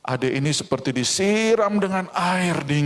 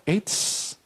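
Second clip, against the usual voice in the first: taunting whisper; 4 semitones higher, 4 dB quieter than usual. Acted.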